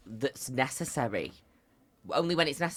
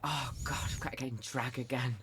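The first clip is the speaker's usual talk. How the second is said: softer mock moan, mildly fed up; weary complaint; 10 dB quieter than usual. Mimicking drag performers who dread one gig, softly moaning. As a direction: imitate a performer grumbling under their breath.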